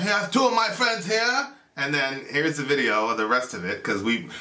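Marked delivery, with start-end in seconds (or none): 0.0-1.5 high, put-on stage announcement. Singer announcing friends at the show, mock stage voice. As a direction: imitate a frontman addressing the crowd. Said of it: "He goes".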